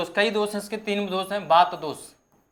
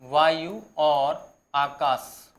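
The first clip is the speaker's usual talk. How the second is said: slow dictation; 3 semitones lower, much slower than usual. Shown as a demonstration. Teacher dictating notes to students.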